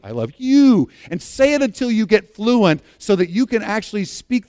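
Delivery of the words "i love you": "you" stretched out and emphatic; stress on YOU. Shown as a demonstration. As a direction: land hard on the final "you". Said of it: "I use different emphasis on different words".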